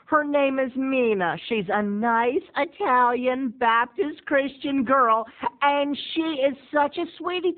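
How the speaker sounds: high, slow sugary mother; sweet church mom. Retold or imitated.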